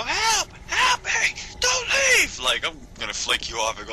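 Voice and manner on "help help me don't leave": very high panicked victim cry; hammed-up high scream; mock screaming for help. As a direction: play a fallen victim screaming after you. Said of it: "you started screaming".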